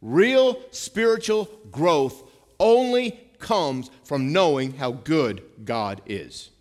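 loud, slow, driving preacher's build; preaching at full force. Real, not acted. Pastor drives home his point to the church, loud slow preaching. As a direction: hammer each word home to the congregation.

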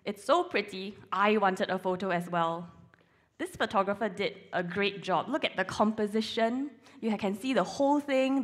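ordinary delivery

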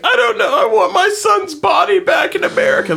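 high, wailing mock mother; imitating his mother. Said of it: "I can just see my mother's face".

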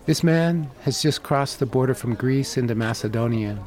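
ordinary talk, nothing marked

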